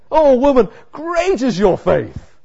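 Jesus overjoyed at her faith, loud and high; delighted. Retold or imitated. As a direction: burst out with delight, then savour each word. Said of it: "You can hear his pleasure".

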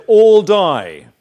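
loud barked order from the guard; guard's threat. Retold or imitated. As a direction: bark it like a camp guard. Guard threatens prisoners with gun.

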